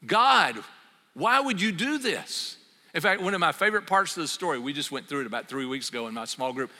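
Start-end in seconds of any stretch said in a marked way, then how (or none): 0.0-2.6 louder, higher indignant cry to God; angry Jonah. Retold or imitated. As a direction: complain bitterly to God as Jonah. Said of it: "No, Jonah is angry".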